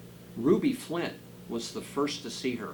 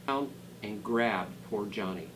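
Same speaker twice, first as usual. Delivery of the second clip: ordinary delivery, nothing marked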